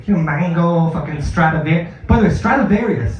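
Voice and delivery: usual speaking voice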